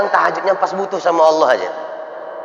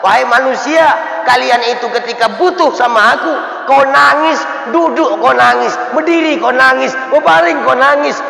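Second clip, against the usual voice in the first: proclaimed divine words; 9 semitones higher, 4 dB louder than usual. Retold or imitated. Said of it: "kata Allah dalam Quran".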